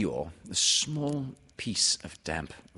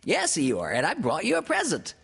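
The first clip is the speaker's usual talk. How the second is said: high, loud, rapid little-creature voice; excited piercing pipe; Piglet; 11 semitones higher, 7 dB louder than usual. A narrator's reading. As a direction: squeal the news out in a rush. Piglet announces a present.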